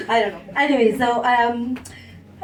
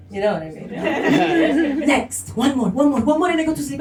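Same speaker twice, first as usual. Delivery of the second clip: normal talking voice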